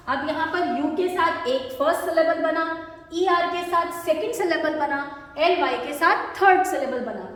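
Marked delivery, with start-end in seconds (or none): none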